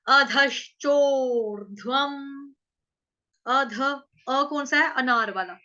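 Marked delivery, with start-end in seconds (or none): 0.1-2.1 syllable by syllable, slowly; slow recitation. Shown as a demonstration. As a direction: chant the word slowly, each syllable apart. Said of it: "ठीक".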